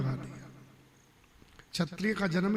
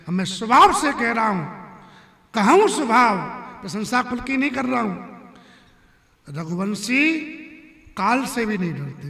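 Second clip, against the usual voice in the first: raised, ringing voice of a proud warrior; emphatic assertion; 4 semitones higher, 7 dB louder than usual. Acted. Ram tells Parshuram he is not boasting of lineage, emphatic. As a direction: declare it firmly and loudly.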